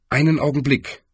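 ordinary delivery

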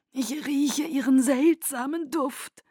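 melodic Aro. Acted. Aro marvels at Bella's heart, high and slow, nearly melodic. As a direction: stretch it out, almost singing. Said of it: "Stimme klang fast melodisch".

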